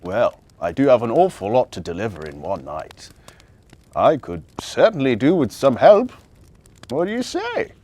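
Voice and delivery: a hint of low Santa voice, friendly; jolly Santa asking. A narrator's reading. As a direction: offer the job as a kindly Santa. Santa offering help.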